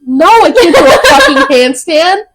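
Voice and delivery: high, loud laughing refusal; loud protest. Real, not acted. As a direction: protest loudly through a laugh. Loudly protests a handstand request.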